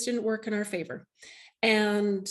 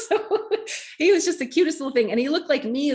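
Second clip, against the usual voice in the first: higher, louder, fast through a laugh; laughing fondly; 6 semitones higher, 5 dB louder than usual. Real, not acted. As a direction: gush about him while still laughing. Laughing fondly about son.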